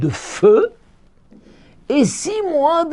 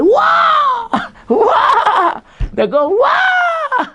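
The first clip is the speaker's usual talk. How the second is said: much higher, louder drawn-out wa; joyful wa; 3 dB louder, much slower than usual. Shown as a demonstration. Rabbi demonstrating the cry of good news, loud and high. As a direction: cry wa with delight. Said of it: "si c'est une bonne nouvelle".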